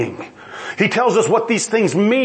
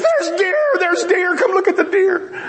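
high-pitched imitation of an excited mother-in-law; hammed-up squeal of excitement at a sighting; 11 semitones higher than usual. Mother-in-law calling people to see the deer, mock excited. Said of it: "she's excited about it".

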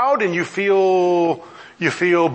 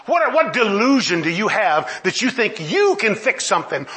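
ordinary talk

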